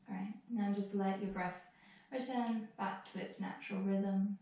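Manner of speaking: calm body-scan guidance with long pauses; guided meditation. Acted. Teacher leading students through a moving body scan, calm meditation voice. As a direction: guide them unhurriedly from one body part to the next.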